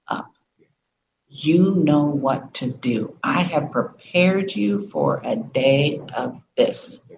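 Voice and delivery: low, slow commands with weighty pauses; solemn inner voice. Retold or imitated. Inner voice rousing her after her losses, grave and deliberate. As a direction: deliver it as a measured, unshakable summons. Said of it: "hearing the voice saying".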